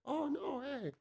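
very high, soft airy voice. Retold or imitated. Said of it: "his weird, eh, fucking [name] accent".

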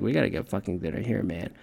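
hungry mutter. Retold or imitated. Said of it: "I was like".

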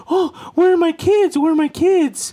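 high, keening wail of a grieving ghost; wailing ghost mother. Retold or imitated. Ghost mother wailing for drowned kids, high keening cry. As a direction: wail it high like La Llorona searching. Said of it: "she started crying and wailing like".

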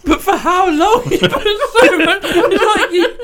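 high-pitched words through laughter; squeaky, breaking into real giggles; laughing disbelief. Real, not acted. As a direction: challenge them while cracking up. Questioning friend while cracking up.

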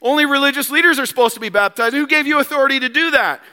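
indignant, loud, challenging voice; mocking religious leaders. Religious leaders confronting John, indignant and loud. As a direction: demand to know who gave him authority. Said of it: "So they're like".

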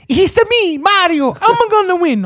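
very high, loud cartoon Mario voice; Mario impression. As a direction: squeal it like the video-game plumber. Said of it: "He was like this".

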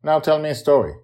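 put-on high, firm child's demand; a girl insisting on her story; demanding little girl. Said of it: "matter-of-factly".

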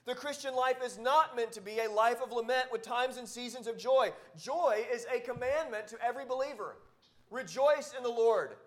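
fervent sermon push; preaching. Acted. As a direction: drive the point home to the congregation. Preaching joy with full force.

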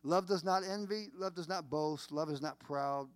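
usual speaking voice

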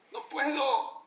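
grito agudo de quien ya no aguanta; desesperación actuada. Retold or imitated. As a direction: imita a un marido desesperado, alzando mucho la voz. Campesino desesperado ante el llanto. (campesino desesperado, exclamando fuerte)

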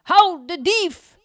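very loud, high, slow shout. A narrator's reading. Neighbour yelling to stop the thief, loud high shout. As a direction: yell after the thief.

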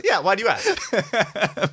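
high-pitched reply through laughter; amused, slow and squeaky; laughing. Real, not acted. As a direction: laugh your way through the question. Laughing reply to height tease.

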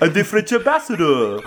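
high cartoon-frog French accent; awful fake French accent. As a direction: croak it in a terrible French accent. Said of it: "awful fake accent that makes him sound like a cartoon frog".

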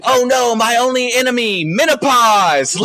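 high, loud mock cry of doom; hammed-up superhero facing an arch-enemy, last word drawn out; melodramatic superhero. Superhero naming her only enemy, loud melodramatic dread.

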